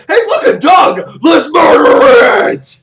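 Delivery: very high, loud, drawn-out mock war cry; rally the mob in hammed-up bloodlust; gleeful mob shout. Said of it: "Everybody goes".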